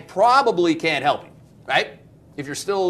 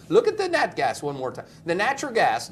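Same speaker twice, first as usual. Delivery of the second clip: usual speaking voice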